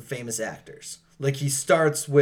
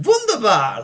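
fake German accent; 7 semitones higher, 7 dB louder than usual. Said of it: "sounds like fake German words".

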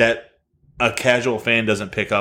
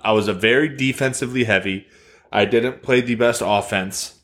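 ordinary talk

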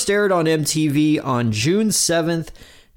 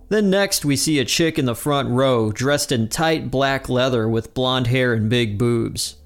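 ordinary talk

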